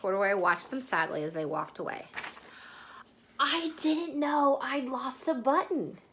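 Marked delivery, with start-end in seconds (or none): 3.4-6.0 higher, slow, small-bear wonder; a small voice, half to himself; little bear to himself. Acted. The bear talking to himself about his button, higher and slow. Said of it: "he said to himself".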